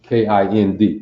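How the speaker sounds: usual speaking voice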